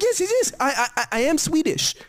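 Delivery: high, hurried mock-nervous stammer; mock flustered girlfriend. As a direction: ham up her nervous stammer. Retold girlfriend's flustered answer.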